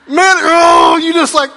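high, loud exasperated cry; mock groan. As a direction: howl like you just got caught out. Mock groan at being teased.

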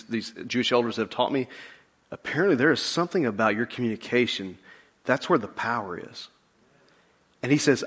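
hushed preaching retelling; soft storytelling. Acted. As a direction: draw them in quietly. Intimate retelling of centurion.

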